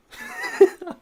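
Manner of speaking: very high loud laugh burst; squealing laugh. Real, not acted. Burst of joy.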